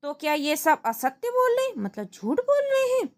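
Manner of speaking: king's pitch soaring in anger; angry king. Acted. King angrily questioning Tenali in court, pitch climbing. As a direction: demand the truth, rising indignantly. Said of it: "राजा क्रोधित गुस्से में".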